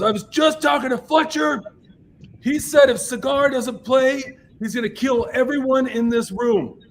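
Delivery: high, loud yelled announcement; Stuart. Retold or imitated. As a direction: imitate a threat hollered across a packed room. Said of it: "like, yelling".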